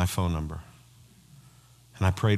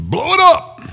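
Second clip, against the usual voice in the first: sudden high, loud holler; explosive mock yell; playful shout; 9 dB louder, slower than usual. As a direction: belt it out like a rowdy hype man. Sudden shout to lighten heavy mood.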